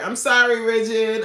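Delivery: loud, high-pitched shout; calling out apology. Real, not acted. Host calling to departed viewer, exaggerated apologetic shout. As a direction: call after someone who has gone. Calling after guest who left.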